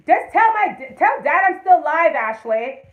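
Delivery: high, loud call to someone off-mic. Real, not acted. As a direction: holler to your daughter across the house. Calls out to daughter mid-stream.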